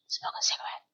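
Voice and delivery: unvoiced whisper. Vlogger mocks the excuse in a whisper. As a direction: breathe it out, no voice. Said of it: "To smoke a cigarette".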